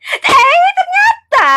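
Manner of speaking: high shocked reveal; hammed-up surprise; surprised gasp. Dramatic reveal in story.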